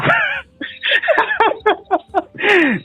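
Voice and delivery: squealing laugh that nearly turns to crying; high laughing fit. Real, not acted. Laughing helplessly while telling a friend about the scolding. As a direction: laugh until it almost breaks.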